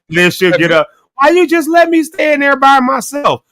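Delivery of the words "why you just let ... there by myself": high-pitched imitated complaint; mock wife complaint. Husband imitating his wife who slept through being woken, mock complaining.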